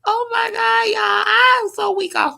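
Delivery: high, breaking voice through laughter; real laughter cracking every word; laughing hard. Real, not acted. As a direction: squeal it out while still laughing. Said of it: "I be making my own self laugh".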